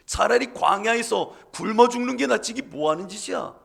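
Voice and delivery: loud enraged mob cry; crowd shouting. Acted. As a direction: roar it like an angry crowd. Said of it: "소리 지르고 어떤 이들은 가슴을 치며".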